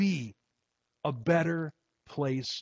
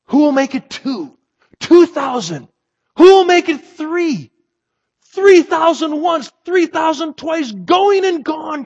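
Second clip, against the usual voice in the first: much higher and louder, building excitement; auctioneer crying out; 15 dB louder than usual. Acted. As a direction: shout the bids to a thrilled crowd. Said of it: "cried he".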